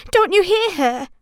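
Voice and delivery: high, urgent protest; boy objecting anxiously. A narrator's reading. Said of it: "protested Ewan".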